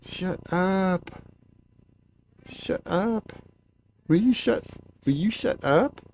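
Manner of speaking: telling the cat to hush, high and playful; high scolding. Real, not acted.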